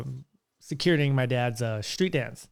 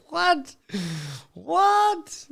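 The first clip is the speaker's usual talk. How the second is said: very high, incredulous squeal; mock disbelief; 14 semitones higher than usual. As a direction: act out baffled amazement, pitched way up. Retold amazed reaction to drunk man's answer.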